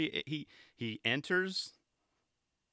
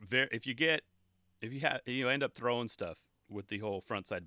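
normal talking voice

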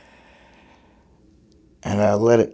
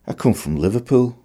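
quick higher Scouse put-on; a light Liverpool accent, pitched up; 5 semitones higher than usual. Shown as a demonstration. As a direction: slip into a Liverpool accent to show it off. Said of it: "in a Liverpool accent".

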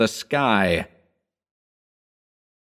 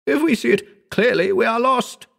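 high, panicked warning; a frightened friend pleading, voice pitched high; terrified shriek. A narrator's reading. Howard warning the narrator in terror, high and panicky. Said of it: "he shrieked".

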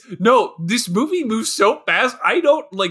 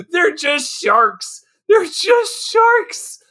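high, drawn-out incredulous protest; slow, rising exasperation, pitched way up; exasperated; 7 semitones higher, much slower than usual. Real, not acted. Co-host protesting the absurd shark myth, exasperated. Exasperated outburst at movie's exposition.

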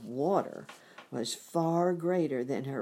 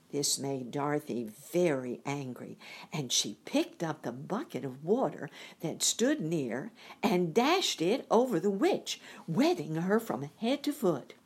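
ordinary delivery

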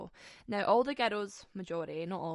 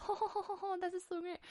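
mock delight; 10 semitones higher, 3 dB quieter than usual. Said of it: "And we're like".

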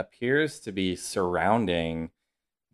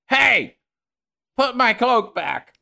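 high, loud holler; indignant shout; 6 dB louder, slower than usual. Acted. Man at the window yelling about his cloak, indignant shout. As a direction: yell it high at a thief below. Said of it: "and he goes".

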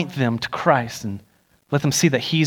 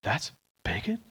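hushed amazement; 5 semitones lower, 11 dB quieter than usual. Retold or imitated. They were like.

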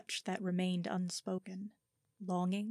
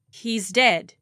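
blunt claim; 2 semitones higher, 13 dB louder than usual. Acted. Lying to the camp watch.

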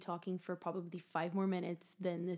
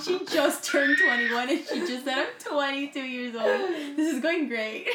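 slow, loud laughing retelling; teasing a friend while laughing; 7 semitones higher, 9 dB louder, slower than usual. Real, not acted. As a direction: drag the words out through laughter. Still laughing, retelling slip.

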